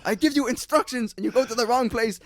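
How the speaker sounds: high, exasperated mock rant; imitating a furious boss, pitched well up; angry boss quote. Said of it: "he's like".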